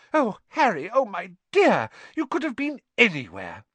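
high, loud, overwrought mother; frantic motherly relief. A narrator's reading. Missus Weasley finds lost Harry, frantic. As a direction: fuss in a high flutter.

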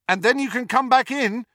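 "back" called out high and loud after her; shouted after her. A narrator's reading. Harry shouts after Ginny running up the steps, loud call. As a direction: call it up the steps as she runs. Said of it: "he shouted after her".

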